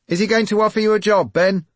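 high, loud reporter's shout; calling out. A narrator's reading. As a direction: shout it across the street. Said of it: "a chorus of voices sang out".

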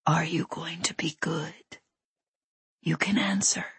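soft, eerie whisper; whispering intruder. A narrator's reading. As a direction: whisper it with controlled, quiet menace. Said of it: "that loathsome whispering man".

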